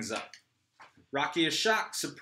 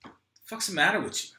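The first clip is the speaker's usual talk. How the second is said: higher, fast exasperated snap; 8 semitones higher, faster than usual. Acted. C scolds Rocky and Joey, exasperated. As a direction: scold them both quickly. C snaps at both.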